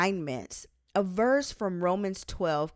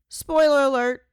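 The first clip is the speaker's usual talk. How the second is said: much louder and higher, teasing; playful aside; 7 semitones higher, 10 dB louder than usual. Acted. Author teasing readers about the book's point, loud and playful. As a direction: let the secret slip with a grin.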